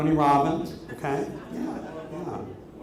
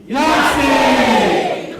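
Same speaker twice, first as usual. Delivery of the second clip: high, loud celebratory yell; group shout; 9 semitones higher, 10 dB louder than usual. Shown as a demonstration. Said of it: "let's try it right now".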